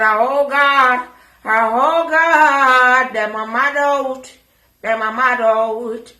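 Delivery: loud, drawn-out hollered mimicry; mock wail. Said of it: "I said".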